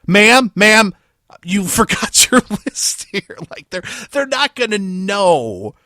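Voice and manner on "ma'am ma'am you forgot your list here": high, loud "ma'am, ma'am" call; helpful stranger calling out. Retold or imitated. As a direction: call after a shopper who dropped her list. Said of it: "somebody in the store is gonna be like".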